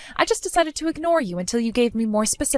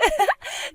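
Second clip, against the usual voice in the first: mocking laugh. Mocking her rival's gift.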